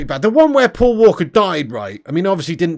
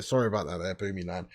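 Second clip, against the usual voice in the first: much lower and much softer, almost murmured; 12 semitones lower, 15 dB quieter, faster than usual. Pretend. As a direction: drop right down as if a viewer asked you to. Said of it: "I can lower my voice a little bit".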